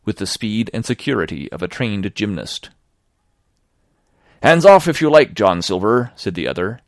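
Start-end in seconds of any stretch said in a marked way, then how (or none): 4.4-6.1 defiant retort. Acted. Sailor defies Silver.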